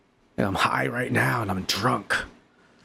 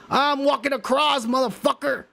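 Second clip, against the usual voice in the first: much higher mock threat; threatening soldier; 11 semitones higher than usual. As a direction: snarl the threat high and hammed-up.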